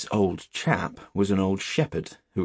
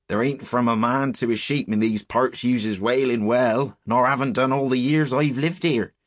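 higher, louder rustic shepherd voice; old country shepherd; 5 semitones higher, 5 dB louder than usual. Acted. Shepherd answering boys about the clump, rustic and emphatic.

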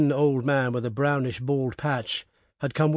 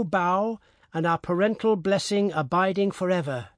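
angry and suspicious; 6 semitones higher than usual. Acted. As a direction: scold him, then dictate crossly. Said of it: "looking at him angrily and suspiciously".

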